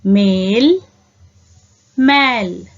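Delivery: मेल with a clean e, then मैल with an open ai, pitch lifting; teacher leading the class in repeating मेल and मैल; read along model. Shown as a demonstration. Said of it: "आप भी मेरे साथ पढ़िए".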